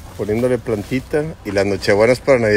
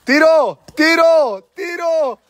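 excited calling out; 3 dB louder, much slower than usual. Real, not acted. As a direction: call for the shot, high and drawn out. Pide el tiro durante el juego. (gritos agudos pidiendo el tiro)

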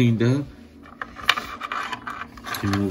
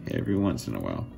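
the same words dropped much lower, to himself; low muttered repeat; 10 semitones lower, much faster than usual. Real, not acted. Low mutter to himself about the failed piece.

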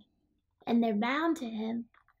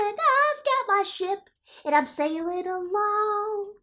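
Max sailing off; 9 semitones higher than usual. Acted. Voices Max gleefully sailing off.

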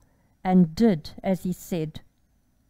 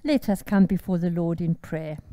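ordinary talk, nothing marked

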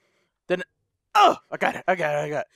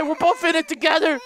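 usual speaking voice

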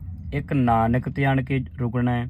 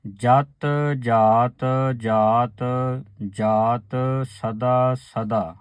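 ਜਤ ਜਾਤ ਜਾਤ drawn out very slowly before a pause; very slow recitation; much slower than usual. Shown as a demonstration. Teacher reciting very slowly so the sangat hears each ਜਾਤ. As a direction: chant each ਜਾਤ slowly, then rest after ਸਦਾ. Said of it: "ਇੱਥੇ ਆਣ ਕੇ ਰੁਕਣਾ ਹੈ".

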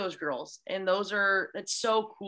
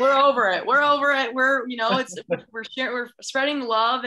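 louder, gleeful, carefree shout; exuberant mock cheer; 4 semitones higher, 7 dB louder than usual. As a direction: toss the covid rules aside with a joyful cry. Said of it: "We're like".

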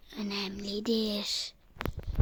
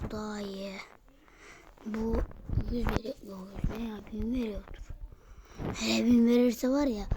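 ordinary talk

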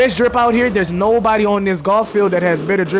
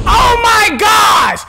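high, loud, slow drawn-out exasperated yell; frustrated shout; 13 semitones higher, 7 dB louder, much slower than usual. Real, not acted. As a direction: stretch the outburst out in disbelief. Chasing fleeing man again.

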